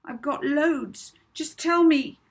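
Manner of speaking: bursting out. A narrator's reading. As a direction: blurt it out desperately. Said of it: "Molly burst out".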